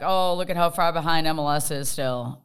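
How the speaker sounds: ordinary talk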